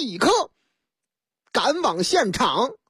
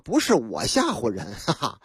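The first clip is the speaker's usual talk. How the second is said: usual speaking voice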